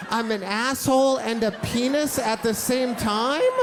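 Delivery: put-on aghast little boy; kid's disbelief.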